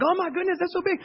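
high, gushing wonder; amazed onlookers. Retold or imitated. Strangers at the dock marvel at the catch, high and gushing. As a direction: gasp it like an impressed bystander.

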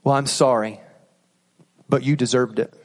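a cutting apology that blames them; bad apology. Shown as a demonstration.

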